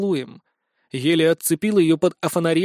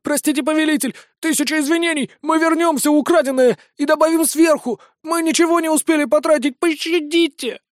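high, panicked goblin begging; frantic babbling plea; 9 semitones higher than usual. Acted. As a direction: babble for mercy, terrified. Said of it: "поспешно залепетал".

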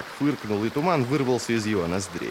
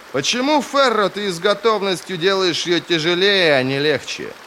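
loud, high-pitched exasperation; exasperated reproach; 10 semitones higher, 5 dB louder than usual. Acted. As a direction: plead crossly with a stubborn companion. Weary mage reproaches her in quarrel. (раздражённый упрёк, громко и высоко)

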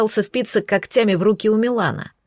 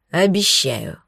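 hoarse; 4 semitones lower, slower than usual. A narrator's reading. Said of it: "но прохрипел".

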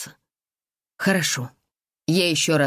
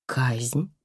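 softer stunned echo; shocked quiet echo; 3 semitones lower, 4 dB quieter than usual. Acted. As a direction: repeat it in disbelief. Heroine shocked by word.

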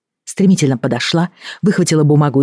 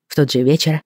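plain reading voice

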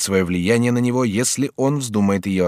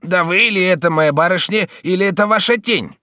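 trembling fright; 9 semitones higher, 5 dB louder than usual. Acted. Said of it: "дрожа всем телом".

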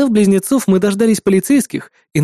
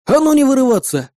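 high-pitched sharp order; stern command; 7 semitones higher than usual. A narrator's reading. Captor to struggling twin on the stairs, stern command. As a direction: order a struggling prisoner to behave.

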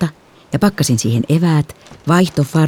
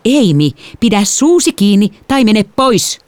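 slow, sharp fatherly snap; snapping father; 7 semitones higher, 3 dB louder, slower than usual. A narrator's reading. Father snapping at the younger daughter at dinner. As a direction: bite the words off at the kid. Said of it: "isä kivahti".

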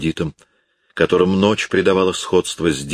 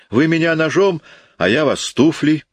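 defiant outburst; 11 semitones higher than usual. Acted. Defiant girl facing bandits.

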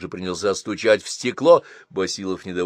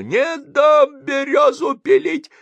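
put-on high, slow, shrieking old woman; little old woman running after the car, shrieking; old woman shouting; 8 dB louder, slower than usual. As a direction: screech it like an angry granny. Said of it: "которая кричала".

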